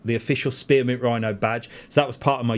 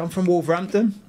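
put-on a light Wolverhampton accent, a touch higher; 6 semitones higher than usual. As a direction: restaurant owner answering in a light Wolverhampton accent. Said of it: "answered me in a Wolverhampton accent".

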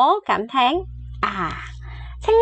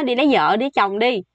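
ordinary delivery

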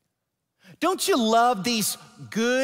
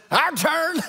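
Nashville's cue; 7 semitones higher than usual. Retold or imitated. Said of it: "Nashville looks at me and says".

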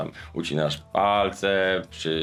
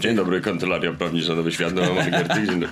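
voice-synthesizer politician impression; lower, synthetic lawyer voice; Roman Giertych voice; 3 semitones lower than usual. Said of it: "to jest syntezator mowy Giertycha".

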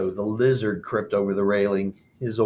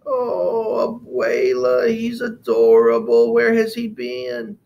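smitten excitement; 12 semitones higher, 6 dB louder, slower than usual. Acted. Martina smitten with mouse.

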